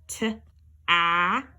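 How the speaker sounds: t-ap drawn out slowly; slow segmented t-ap. Shown as a demonstration. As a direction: stretch t-ap. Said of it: "Now we have".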